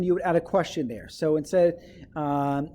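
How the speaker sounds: normal talking voice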